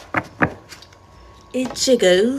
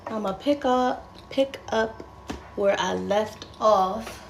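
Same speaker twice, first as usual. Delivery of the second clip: softer playful retake; 2 semitones lower, 6 dB quieter than usual. Pretend. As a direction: say it again softly, playing with the voice. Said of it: "repeats in a new voice".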